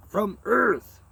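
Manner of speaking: cry. Acted. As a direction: cry it out in frustration. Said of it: "he cried".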